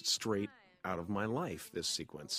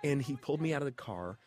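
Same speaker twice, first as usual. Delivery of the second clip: ordinary delivery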